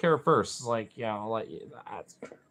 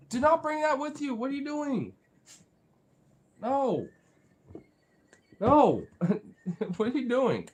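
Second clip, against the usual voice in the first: high-pitched playful protest; scolding a dog; 14 semitones higher, 3 dB louder than usual. Real, not acted. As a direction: protest to the pet in a high falsetto. Stopping the dog bringing something up.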